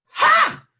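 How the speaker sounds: high, loud scornful "Ha!". Retold or imitated. Mother showing she dislikes the girlfriend, very loud "Ha!". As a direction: blurt a dismissive "Ha!". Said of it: "very out loud".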